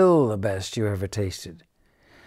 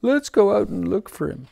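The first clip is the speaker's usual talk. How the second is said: high, fast, urgent cat voice; eager cat; 10 semitones higher, 4 dB louder, faster than usual. Acted. The cat urges the squirrel to go search. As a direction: hurry the others out the door.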